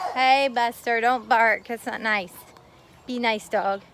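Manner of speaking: firm call across the yard to Buster; calling to the dog. Real, not acted. Scolding the barking dog.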